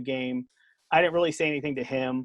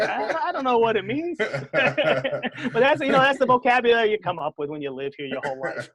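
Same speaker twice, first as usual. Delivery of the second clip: laughing; 8 semitones higher, 4 dB louder than usual. Real, not acted. Laughing about Boston slang.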